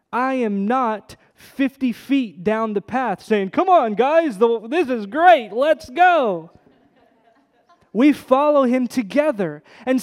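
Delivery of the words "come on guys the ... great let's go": louder, higher, cheering the group along; calling out ahead. Retold or imitated. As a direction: shout back down the path to urge them on. Leader calling group on trail.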